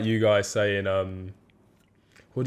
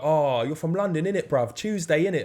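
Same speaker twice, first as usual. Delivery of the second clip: higher mock London slang from a stranger; 6 semitones higher than usual. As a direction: play an annoying stranger faking the accent. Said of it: "they come and be like".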